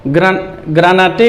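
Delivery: "ग्रानाटे" with the final e wrongly sounded as "tay"; wrong pronunciation. Shown as a demonstration. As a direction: end "ग्रानाटे" on a voiced "tay". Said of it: "नहीं पढ़ेंगे".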